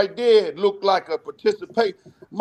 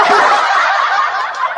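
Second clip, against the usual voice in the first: high cackle; 6 dB louder than usual. Real, not acted. Laughing at own boast.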